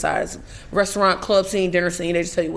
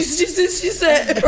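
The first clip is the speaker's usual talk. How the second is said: high, slow stammer through laughter; hammed-up stuck-on-the-words panic; mock freaking out; 7 semitones higher, slower than usual. Showing how she was on set, mock panicked stammer. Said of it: "this is how I'm on set".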